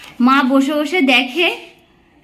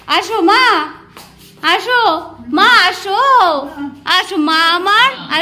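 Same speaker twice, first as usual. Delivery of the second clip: higher, slow, drawn-out pleading; sing-song coaxing her over; stretched-out sweet coaxing; 4 semitones higher, slower than usual. Real, not acted.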